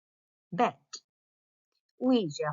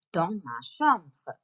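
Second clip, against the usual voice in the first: even slower repeat; slow model reading; much slower than usual. Shown as a demonstration. Teacher repeats title very slowly. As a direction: drag each word out for the class. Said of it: "here is your title".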